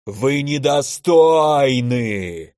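very slow, drawn-out monster bellow; furious elemental roar. A narrator's reading. Elder elemental flying into a rage at mages, furious roar. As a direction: roar it slowly as a raging fire giant. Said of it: "яростно заревел элементаль".

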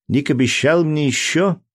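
put-on high, whiny child asking; a small boy pleading for a promise; little boy voice. A narrator's reading. Jack insisting.

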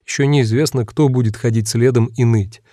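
ordinary delivery

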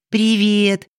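high ringing fairy greeting; pixie. Acted. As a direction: chime it like a tiny fairy. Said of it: "звоном прозвучал голос феи".

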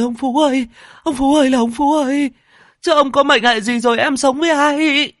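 very high, loud lamenting cries; frantic widow calling out; wailing wife. A narrator's reading. Wife wails for husband Phú and orders torches, frantic.

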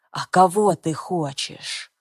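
slow cutting male voice; menacing man. Acted. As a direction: lash it out like a whip. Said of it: "Голос звучит, как удар плетью".

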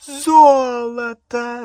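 high, loud, long-drawn mockery; jeering, cackling cry; derisive cackle. A narrator's reading. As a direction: stretch the word out in scornful glee. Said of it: "расхохоталась Беллатриса".

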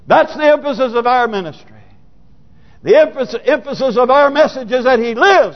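high, fervent proclamation; triumphant preaching. Acted. Preacher proclaiming that He lives, triumphant preaching. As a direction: build in excitement, repeating for emphasis.

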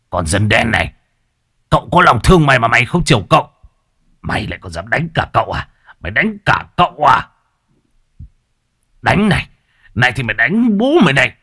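put-on enraged young master, fast and loud; furious hissing rage. A narrator's reading. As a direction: spit the words in drunken fury. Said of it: "mà rít lên".

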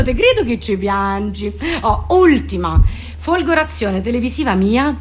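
usual speaking voice

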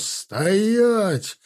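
high, drawn-out order to halt; yelled command. A narrator's reading. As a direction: order them to freeze. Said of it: "заорал Тимофей".

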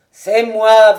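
loud impatient call; shout. A narrator's reading. Master outside the gate, shouting loud. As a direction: bark it through the locked door.

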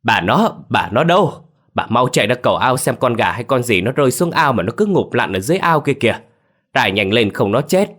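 loud urgent call across the yard. A narrator's reading. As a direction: shout to grandma from the pond. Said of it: "nghe tiếng ông tôi gọi với". (gọi với lớn, hốt hoảng)

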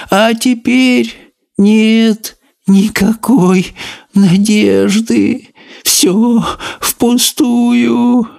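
very high, slow despairing snail voice. A narrator's reading. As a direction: drag it out in tiny hopeless whimpers.

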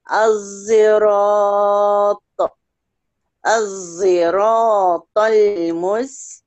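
الزراط said slowly, the sad blended toward a z sound. Shown as a demonstration. Teacher demonstrates Hamza's reading slowly to her student. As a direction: stretch out الزراط with a z-colored sad. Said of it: "بيشمها في إشمام هنا بصوت حرف الزاي".

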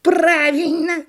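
high, loud, drawn-out squeal; shrieky cartoon monkey; excited monkey. A narrator's reading. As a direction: squeal agreement like a cheeky monkey. Monkey figure speaks.

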